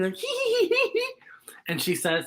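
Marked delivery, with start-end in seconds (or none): 0.2-1.1 squeaky high giggle; high giggling. Acted. Storyteller voicing two friends, high giggle. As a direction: giggle like a delighted little kid. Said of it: "they started giggling".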